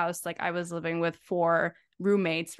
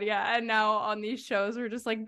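usual speaking voice

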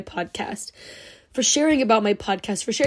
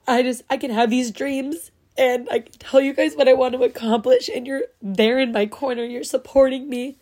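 ordinary delivery

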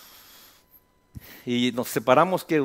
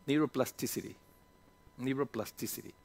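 quiet repetition; 3 semitones lower, 12 dB quieter than usual. Shown as a demonstration. Repeats the English term to himself.

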